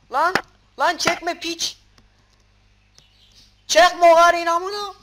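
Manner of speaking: loud, high cursing yell; furious shout. Real, not acted. Gamer screaming curses at a mob dragging him. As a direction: scream it in full rage.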